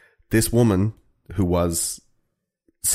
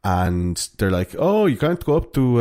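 put-on Finnish accent. Host voicing Finnish teens griping about the lake, mock Finnish accent. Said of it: "That's a pretty good Finnish accent".

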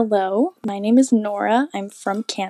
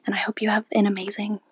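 whispered goodbye. Acted. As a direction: breathe the last words like a secret. Whispered sign-off on voicemail.

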